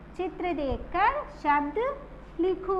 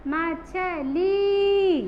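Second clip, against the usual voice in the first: मछली slowly, with ली held out; long stressed ली; much slower than usual. Shown as a demonstration. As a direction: stretch the final ee of मछली. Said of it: "ला पे बड़ी की मात्रा". (मछली में ली लंबी खींचकर)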